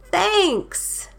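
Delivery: high, bright storybook exclamation; exclaimed thanks. A narrator's reading. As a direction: sing out thanks as the bear. Bear thanks Badger.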